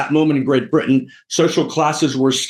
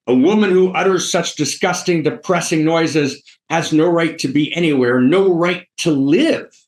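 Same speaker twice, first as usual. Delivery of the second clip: high-pitched theatrical denunciation; revolted Higgins; 5 semitones higher than usual. Retold or imitated. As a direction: declaim it in disgust, drawing out the last phrase. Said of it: "you know, revolted".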